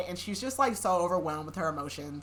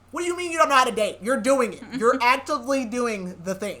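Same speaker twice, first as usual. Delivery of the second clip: fast, higher, louder disbelief; exasperated rant; 4 semitones higher, 6 dB louder than usual. Real, not acted. Reader ranting at the heroine about dating, fast exasperated. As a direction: rattle it off in frustration.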